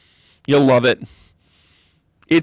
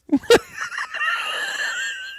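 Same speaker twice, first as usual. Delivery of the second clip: high, shrieking burst of real laughter; a real fit of high-pitched laughter; hysterical shrieking laugh; 5 dB quieter than usual. Real, not acted. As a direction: crack up helplessly, squealing. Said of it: "Oh my God, I'm hysterical".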